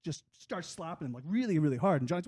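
usual speaking voice

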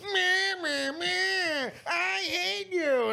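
high, slow whiny mock; mocking blah blah. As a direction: whine it like a sulky child. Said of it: "And then meanwhile".

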